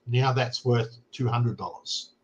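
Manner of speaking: usual speaking voice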